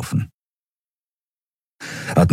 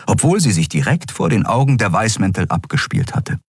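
usual speaking voice

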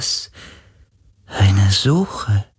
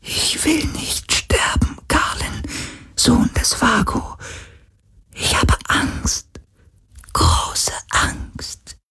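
softer, crying ghostly whisper; afraid whisper; 4 dB quieter, slower than usual. Acted. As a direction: whimper in fear of dying. Dying ghost fears death.

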